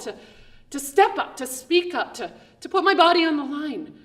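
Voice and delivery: ordinary talk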